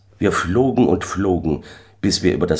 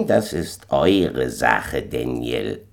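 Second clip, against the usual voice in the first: slow, lower voice of an unwelcoming host; curt moon man; 5 semitones lower, much slower than usual. A narrator's reading. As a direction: refuse him slowly, dropping low on the name. Mann im Mond antwortet abweisend.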